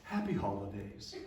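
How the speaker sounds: sweet reply. Retold or imitated. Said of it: "I was so sweet to her".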